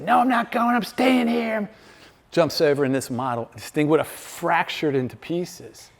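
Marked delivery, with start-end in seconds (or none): 0.0-1.7 much higher, stubborn teen refusal, lightly voiced; defiant teenage son. Father voices his son refusing to move, defiant. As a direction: play the son digging in his heels. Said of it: "What if he said".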